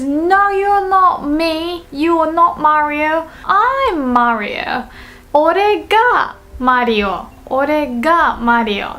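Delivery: slow, emphatic objection to an impostor; Mario. Acted. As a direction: insist on your identity, lightly acted. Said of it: "But Mario comes in and says".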